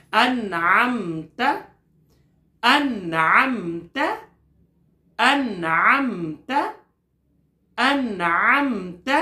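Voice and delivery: drawn-out tajweed recitation; slow chanted recitation; slow Quran recitation. Shown as a demonstration. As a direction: stretch the word for students to echo. Teacher recites word.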